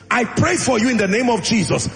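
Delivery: loud, high, forceful prayer declaration; full-force preaching. Real, not acted. Preacher praying over the fathers in the congregation, full-force preaching.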